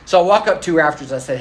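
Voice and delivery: ordinary delivery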